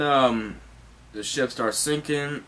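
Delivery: ordinary talk, nothing marked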